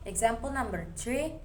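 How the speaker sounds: ordinary delivery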